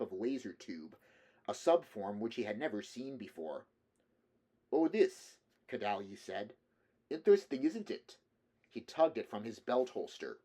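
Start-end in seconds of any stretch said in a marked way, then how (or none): 4.7-5.4 put-on high, loud Slavic-accented voice. A narrator's reading. Russian man shows off his laser tube, Slavic accent. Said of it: "the man asked with a Slavic accent". 7.1-8.2 put-on high, loud Slavic-accented voice. A narrator's reading. Russian man shows off his laser tube, Slavic accent. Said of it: "the man asked with a Slavic accent".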